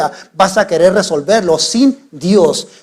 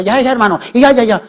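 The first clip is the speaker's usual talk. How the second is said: pastor scolding a member, lightly raised; half-shouted, higher-pitched telling-off; mild mock yelling; 5 semitones higher, much faster than usual. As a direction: imitate yelling at someone, without full force. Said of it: "le empiezo a gritar".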